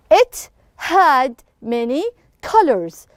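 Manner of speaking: each word set apart with short pauses; deliberate classroom model reading; slow word-by-word reading. Shown as a demonstration. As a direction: read it slowly, word by word, for a class. Teacher reads sentence out for class.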